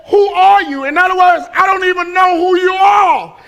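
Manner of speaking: high, loud startled shout; Saul shouting back. Retold or imitated. Preacher shouting Saul's reply from the ground, loud. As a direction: yell it up like a man knocked flat. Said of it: "he says".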